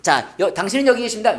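ordinary talk, nothing marked

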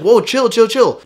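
high, loud soothing whoa, easing someone down; a cartoon angel hushing the devil with a high, loud call; calming angel. Angel on the shoulder calms the devil, high and loud. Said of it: "And the angel's like".

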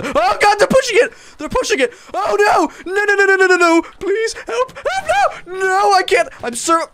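high, loud panicked shrieks as the army topples his tower; panicked screaming. Real, not acted. Gamer shrieking as monsters tip his tower, panicked. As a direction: scream for help, losing it.

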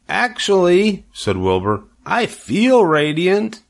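high, slow, pleased piglet voice; a pig savouring his new word; proud little pig. Acted.

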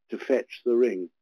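normal talking voice

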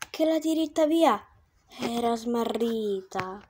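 slow, soft verse reading; poem recitation. A narrator's reading. Narrator opening the tale, solemn poem reading.

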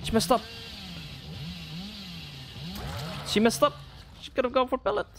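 high-pitched, giddy gamer cheering; gleeful excitement. Real, not acted. Player crowing as she messed up, gleeful excitement. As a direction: squeal it out high, crowing at the mistake. Celebrates opponent messing up in game.

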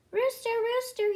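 mock farmer calling. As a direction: call across the yard for the rooster. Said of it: "he called".

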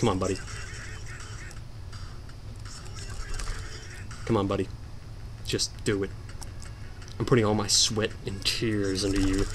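low, soft coaxing under pressure; quiet urging. Real, not acted. Player putting sweat and tears into the game, quiet urging. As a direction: urge it on softly, keeping concentration. Coaxes himself quietly mid-attempt.

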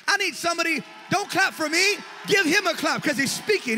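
calling out. Real, not acted. Preacher shouting at the congregation to clap for the boy. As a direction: rouse the room to clap.

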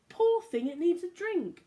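bright, lilting storybook voice; reading to children. A narrator's reading. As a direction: read it like bedtime story time for little ones. Reading picture book aloud.

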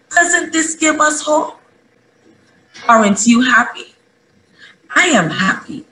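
put-on rally the kids with joyful hope; eager, rising pulpit appeal; rousing children's sermon. Closing exhortation to children.

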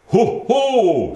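high, loud fumbled ho ho; a father trying Santa's laugh and missing; high, loud botched Santa laugh; clumsy Santa laugh. A narrator's reading. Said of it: "du gør det altså helt forkert".

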